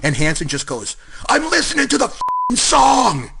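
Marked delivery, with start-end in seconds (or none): 1.3-3.3 high, slow, put-on grumpy voice; gruff retort. Hansen to the ref during the anthem, slow high grumble. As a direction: drag it out like a sulky brute.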